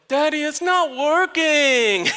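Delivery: put-on high, drawn-out little-girl whine; whining child. Daughter complaining to dad about the pedals, imitated whine. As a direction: imitate a frustrated small child, very slowly.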